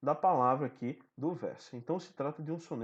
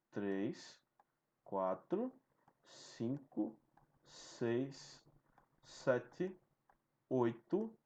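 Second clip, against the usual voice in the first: counting syllables; 4 semitones lower, 5 dB quieter, much slower than usual. Shown as a demonstration. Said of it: "Vamos ver se conta até dez".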